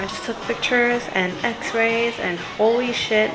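muffled behind mask; speaking under mask; through a face mask. Real, not acted.

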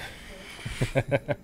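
breathy suppressed giggle; stifled laugh. Real, not acted. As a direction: laugh under your breath. Host bursts laughing at own joke.

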